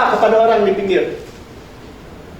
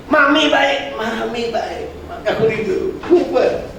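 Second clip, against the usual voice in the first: chick voice; 2 semitones higher, much slower than usual. Retold or imitated. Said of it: "Kalau anak ayam bilang gini".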